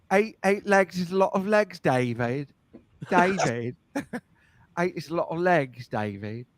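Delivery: put-on lightly done British accent, Love Actually style; a hint of a British prime minister voice; mock British accent. Host imitating a British film line, a light British accent. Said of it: "it sounds like the one from, uh, Love Actually".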